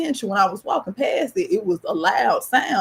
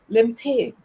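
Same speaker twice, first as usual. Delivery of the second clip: normal talking voice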